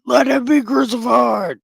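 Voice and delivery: a lightly voiced mob yelling; hammed-up angry crowd cry; mock crowd shout. Crowd demands crucifixion.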